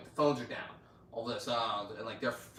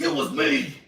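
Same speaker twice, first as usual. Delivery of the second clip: loud, higher-pitched retold outburst; mock anguished confession; 5 semitones higher, 10 dB louder than usual. Retelling man confessing to Frenchie, mock anguished shout. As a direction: blurt the confession out at full volume.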